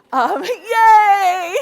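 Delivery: high, loud laughing cry of joy; a real burst of delight with a laugh; excited cheer. Real, not acted. Cheering her husband in audience.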